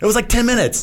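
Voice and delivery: answering the friend's teasing in high mock outrage; indignant protest. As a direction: protest like it's obvious, pitch jumping up. Defending himself to teasing friend.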